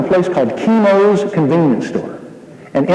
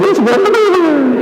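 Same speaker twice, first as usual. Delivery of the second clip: nervous greeting that jumps much higher and breaks; voice cracking; 12 semitones higher than usual. Retold or imitated. Frightened speaker greets the packed gym, voice cracking. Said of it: "my voice cracked".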